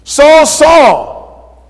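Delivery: high, loud stern calling of the name; stern rebuke. Acted. The Lord rebuking Saul, stern angry calling. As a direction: rebuke him sharply by name. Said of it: "the Lord's angry in this".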